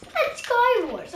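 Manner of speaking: high, loud repeated "And Skywars"; loud shout. Shown as a demonstration. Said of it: "repeated louder higher".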